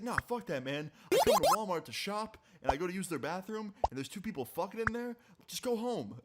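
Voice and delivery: high-pitched imitation of an indignant guy yelling; retold yelling. Guy refuses to stop and rants at the couple, mock yelling. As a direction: rant in a mock yell, pitched well up. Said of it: "stop talking and yelling at these people".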